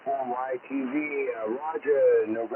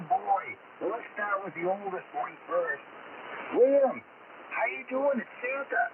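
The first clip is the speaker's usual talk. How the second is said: put-on higher, hearty Santa Claus with lots of oh boys; jolly Santa voice; 10 semitones higher than usual. Santa on the radio greeting kids, jolly and hearty. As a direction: play a cheerful Santa over the radio to excited kids.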